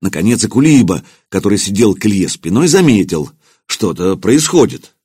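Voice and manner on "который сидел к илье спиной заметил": ordinary delivery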